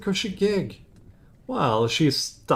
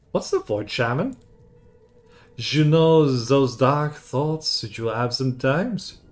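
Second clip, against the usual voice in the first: ordinary talk, nothing marked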